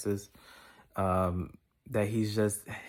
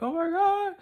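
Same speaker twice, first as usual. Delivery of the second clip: a squeaky, much higher mock wail; mock panicked wail; slower than usual. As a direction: shriek it like someone melting down. Person losing it in a crisis.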